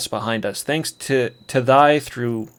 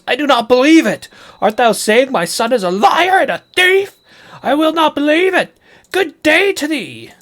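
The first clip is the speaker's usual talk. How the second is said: much higher, loud indignant shriek; outraged high-pitched parent; 14 semitones higher, 5 dB louder than usual. Acted. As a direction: refuse to believe it, shrilly outraged. Outraged parent denies, acted.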